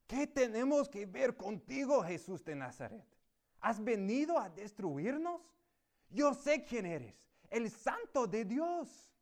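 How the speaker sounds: loud, high demon cry; a touch of a shrieking spirit. A narrator's reading. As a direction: cry out as the possessed man. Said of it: "el cual comenzó a gritar".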